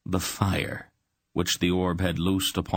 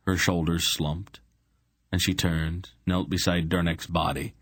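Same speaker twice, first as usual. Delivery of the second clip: usual speaking voice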